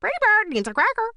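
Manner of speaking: very high, loud bird squawk; shrieky talking-bird voice; squawking parrot. A narrator's reading. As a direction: screech it like a pet parrot. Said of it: "Poirot squawked".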